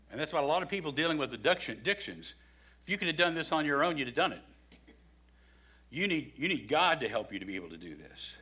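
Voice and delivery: ordinary delivery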